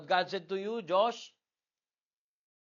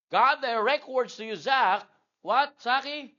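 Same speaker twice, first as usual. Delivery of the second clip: loud call-out; 8 semitones higher, 8 dB louder than usual. Real, not acted. Calling child to answer. (malakas na pagtawag)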